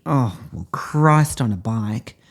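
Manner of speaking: ordinary talk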